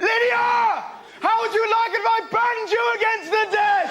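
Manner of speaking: high, loud furious yell; teacher shouting. Retold or imitated. Comic recalls teacher yelling at Lydia, furious shout. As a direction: bellow at the pupil, drawn out slowly. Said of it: "our teacher went".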